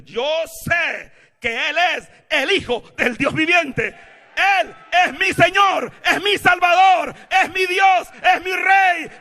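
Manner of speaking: high, full-force triumphant shout; defiant declaration. Acted. Believer telling the devil who Jesus is, defiant shouting. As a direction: shout each title like a victory cry. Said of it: "Gánale al diablo esta mañana y dile".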